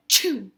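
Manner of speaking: loud acted "achoo"; mock sneeze. A narrator's reading. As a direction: fake a sudden storybook sneeze. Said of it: "Hare starts to sneeze".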